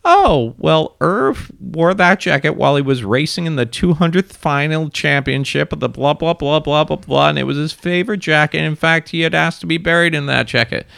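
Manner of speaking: high-pitched acted widow's voice; woman reminiscing. Seller telling a buyer about the jacket, high rambling act. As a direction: ramble fondly in a high lady's voice. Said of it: "this woman's like".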